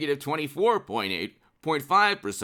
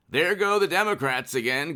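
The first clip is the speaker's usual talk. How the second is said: ordinary talk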